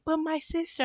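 soft, high-pitched little plea; small, high protest in mock appeal; mock pleading. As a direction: lightly imitate a sister making excuses. Voices sister pleading for her sibling.

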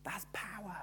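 softly whispered in wonder; awed whisper. Real, not acted. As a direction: drop to a hush of reverence. Awed whisper after a point.

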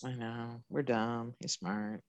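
soft, low self-mocking mumble; quiet mumble. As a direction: mutter it under your breath, downcast. Joking they're dumb.

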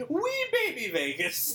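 put-on high, slow teasing baby-talk voice; mock baby talk. Teasing Reno as baby Vegas.

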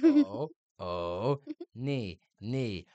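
oh and knee each sounded out, then echoed; measured lesson echo of oh, knee; syllable-by-syllable coaching. Retold or imitated. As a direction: drill oh and knee as separate syllables. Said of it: "I can imagine her being like".

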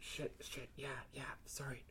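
whispered, flustered self-reproach; hushed apology. Acted. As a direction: mutter it under your breath, exhausted. Exhausted woman realises she forgot.

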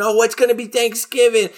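high put-on cat character voice; whining cartoon cats. Host voices starving cats worrying about the holiday, high mock complaint. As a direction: voice a hungry cartoon cat, pitched well up.

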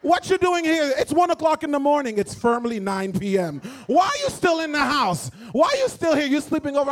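loud, high Haitian mom scolding; mother with thick Haitian accent shooing the boyfriend home; Haitian mother's accent. Retold or imitated. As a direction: play the Haitian mother fed up at one in the morning. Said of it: "her Haitian mother and her big Haitian accent be like".

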